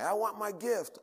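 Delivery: put-on eager child. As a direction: imitate a child asking for a present. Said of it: "all the children like".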